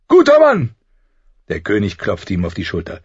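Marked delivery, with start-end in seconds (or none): 0.1-0.7 high, booming approval; hearty cheer. A narrator's reading. King praising his friend's offer, hearty shout. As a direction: shout it with delight.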